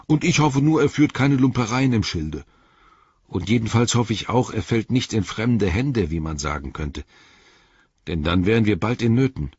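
lightly voiced grumbling companion; lightly voiced wary Sam. A narrator's reading. Sam answers Frodo's hope about the missing guide, mildly grumbling.

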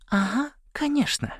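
very high, small squeaky voice; tiny squeak. A narrator's reading. A hidden little voice at Саймон's ear, squeaking. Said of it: "пискнул голосок у уха Саймона".

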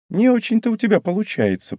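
lightly mock a rival with a lifted voice; ironic. A narrator's reading. Торимазу doubts her rival's chances, ironic. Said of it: "произнесла Торимазу иронично".

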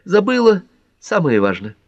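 high-pitched sudden recollection; exclaiming. A narrator's reading. Pat suddenly remembering the invitation, high exclamation. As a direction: exclaim it, voice jumping up. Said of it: "воскликнула Пэт".

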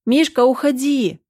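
high, urgent call; high-pitched shout through a door; shouted warning. A narrator's reading. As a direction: cry out to send him away. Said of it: "крикнула я".

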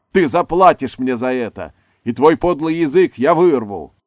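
very loud, high threatening bellow; a raging actor bellowing at his partner; furious roar. A narrator's reading. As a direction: roar the threat with full fury. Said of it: "Такого яростного рёва".

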